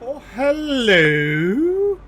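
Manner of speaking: drawn-out hello. Real, not acted. Greeting something new.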